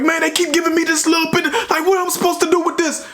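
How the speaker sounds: high, fast, heated griping; a retold friend fuming about his tiny portion; imitated angry complaint. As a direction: rattle off the complaint to the guard in mock outrage. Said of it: "flipping out about the food".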